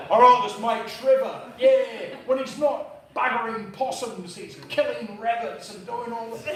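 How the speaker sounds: loud, hammed-up mate's description; hearty retold boast. Brother-in-law describing his mate Trevor, loud and hearty. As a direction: belt it out like a proud mate.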